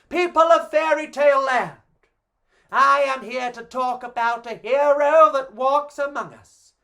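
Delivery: big booming public address; grand announcement. Acted. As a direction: announce it like a herald to the whole land. Goldilocks addresses fairy tale crowd.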